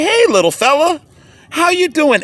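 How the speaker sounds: put-on high, sing-song greeting to a little boy; talking to a toddler. Kneeling down to greet a small boy, tender. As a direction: coo gently at a three-year-old. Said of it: "So I kneeled down and I say".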